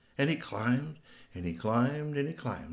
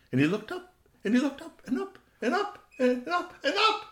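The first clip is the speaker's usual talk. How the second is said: each "and up" pitched higher than the last; rising higher and higher. A narrator's reading. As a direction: climb the pitch as the eyes climb the tree. Gaze climbing the tall tree.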